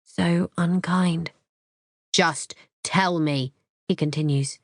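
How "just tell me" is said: slow, separated words; harsh insistence. A narrator's reading. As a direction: spell the demand out slowly. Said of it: "So harsh".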